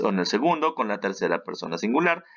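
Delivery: normal talking voice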